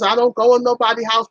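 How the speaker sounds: normal talking voice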